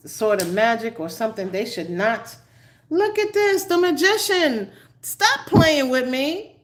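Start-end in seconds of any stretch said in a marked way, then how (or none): 2.9-6.6 pitched up high and a bit louder, half laughing; exasperated at the card. Real, not acted. Reader scolding the Magician card, high exasperated outburst. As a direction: scold the tarot card like it's teasing you.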